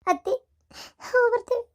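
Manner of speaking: weepy farewell to mother-in-law; tearful goodbye. Acted. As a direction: say goodbye through tears, voice climbing. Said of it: "ಅಳ್ಬೇಡ ಹುಚ್ಚಿ".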